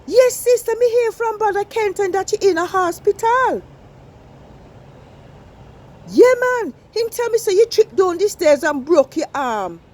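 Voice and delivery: lively Jamaican sister catching up; Jamaican patois. Acted. Jamaican woman on the phone to her sister in hospital, patois.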